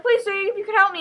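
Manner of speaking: high-pitched, pleading cry for help; urgent plea. Acted. Tessa begs a man on the stairs, high urgent plea. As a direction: beg a stranger while fleeing.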